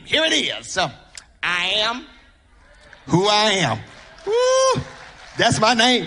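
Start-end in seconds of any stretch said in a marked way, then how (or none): 4.3-4.8 a sudden high church whoop of delight; joyful high whoop. Real, not acted. Preacher whooping to the congregation, high. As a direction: let out a high excited woo.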